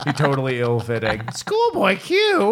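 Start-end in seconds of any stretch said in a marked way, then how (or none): 1.4-2.5 very high, slow cartoon mom; Marge Simpson. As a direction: stretch the name out in a high put-on voice. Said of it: "It's like, Marge".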